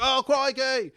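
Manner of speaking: sudden high, loud delighted cry; excited exclamation. Retold or imitated. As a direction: yelp it out in surprise. Crowd bouncing at the concert.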